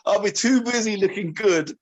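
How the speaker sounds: playful movie quote; movie line impression. Shown as a demonstration. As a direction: deliver it like the film character. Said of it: "Now you have to do that one".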